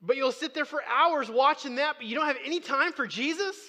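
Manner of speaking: loud, high, incredulous challenge; challenging preaching. Real, not acted. Preacher confronting binge-watchers about time for Jesus, challenging preaching. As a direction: preach it as a pointed question.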